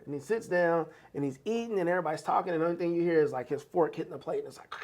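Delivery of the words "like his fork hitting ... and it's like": hushed whisper. Retold or imitated. Retelling the silent Thanksgiving dinner scene in a hushed whisper.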